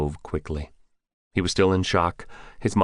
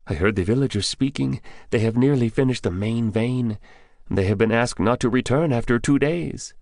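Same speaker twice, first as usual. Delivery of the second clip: higher, lightly voiced young Afghan man; higher young man telling what he knows; young villager Bidar; 7 semitones higher than usual. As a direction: voice the scared young grocer confiding.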